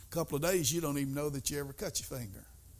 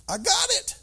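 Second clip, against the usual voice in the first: much higher, loud and generous; God calls out, offering freely; God's booming answer; 11 semitones higher, 9 dB louder than usual. Acted.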